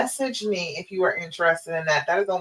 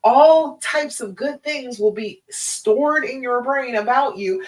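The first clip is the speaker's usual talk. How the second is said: ordinary talk, nothing marked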